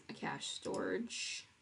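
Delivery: usual speaking voice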